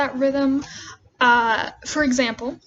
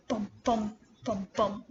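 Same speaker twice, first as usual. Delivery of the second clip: "bum, bum" in pairs, second bum stressed; heartbeat rhythm; 6 dB quieter than usual. Shown as a demonstration. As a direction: sound out "bum, bum" like a steady heartbeat. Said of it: "it has this kind of".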